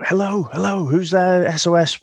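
high-pitched mock distress call; British radio distress caller. British sailor radioing the coast guard, mock distress call. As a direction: call out over the radio, acting urgent. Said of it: "British person, he goes".

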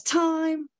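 high, held referee-style call; acted shout. A narrator's reading. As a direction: call time on one long high note.

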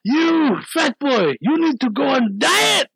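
high, slow, loud taunt; imitated drunk heckling. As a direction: drag out each mocking shout. Imitating drunk men heckling friend.

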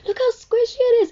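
high, mock-fascinated squeal; amazed classmates. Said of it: "Everyone in my class was like".

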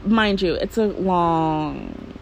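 usual speaking voice

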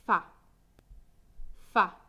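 "fa" said alone as a short stressed beat; strong syllable alone. Shown as a demonstration. Teacher showing learners the strong syllable, "fa" stressed alone. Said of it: "La sílaba fuerte es".

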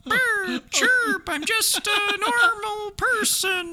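very high squeaky bird voice, slow. Pretend. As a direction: squeak it like a bird pretending to be human. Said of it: "a bird trying to disguise itself".

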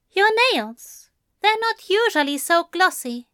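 high-pitched angel voice; high, prim remark; curious angel. A narrator's reading. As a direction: pitch it well up, pointedly observing.

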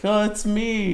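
innocent reply. Retold or imitated. Sleepy reply to police.

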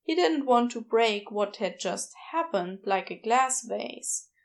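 ordinary delivery, nothing marked

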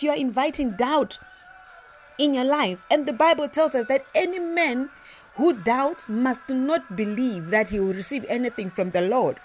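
ordinary talk, nothing marked

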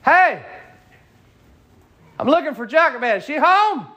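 high, loud call from the doorstep; calling at the door. Acted. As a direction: call out for Jochebed.

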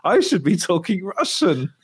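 high, slow put-on voice; mock actor voice. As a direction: play the amused actor admitting it. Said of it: "He's like".

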